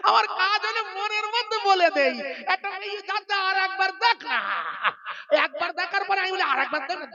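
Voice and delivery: high tempting devil voice; wheedling Satan. Retold or imitated. Satan explaining to the Prophet, high and tempting. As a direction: coax a man to look again. Satan confesses his whispering.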